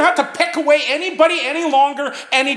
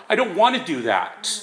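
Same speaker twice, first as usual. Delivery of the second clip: ordinary talk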